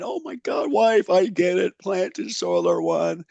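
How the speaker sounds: a high burst of discovery, then slows on the last words; excited shout. Retold or imitated. Shouting to his wife from the bedroom, thrilled. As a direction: retell a thrilled eureka yell to the wife. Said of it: "I came screaming out of my bedroom going".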